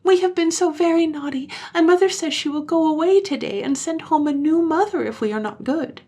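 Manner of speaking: put-on high, loud child voice pleading; high childish pleading; pleading children. A narrator's reading. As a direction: beg urgently as little children.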